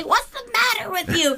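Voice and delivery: hammed-up cop yell; imitating the cop. Podcast host quoting the cop yelling at Ted, hammed-up and high. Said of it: "What does he say?".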